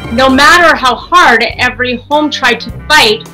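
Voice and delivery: plain reading voice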